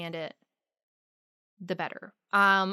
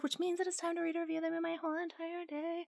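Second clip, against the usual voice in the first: excited; 9 semitones higher, 9 dB quieter than usual. Real, not acted. Excited review intro.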